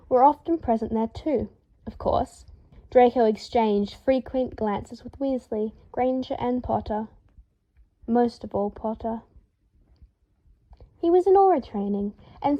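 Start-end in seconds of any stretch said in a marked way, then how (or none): none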